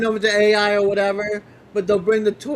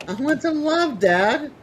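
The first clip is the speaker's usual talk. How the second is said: pitched-up pleading puppy voice; dog's plea; 7 semitones higher, slower than usual. Pretend. Guest voicing the puppy begging its dad, high and pleading. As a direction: beg like a needy puppy.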